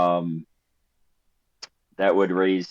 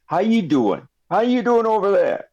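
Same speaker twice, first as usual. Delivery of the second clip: chummy shopkeeper; 7 semitones higher than usual. Acted. Shopkeeper greets party.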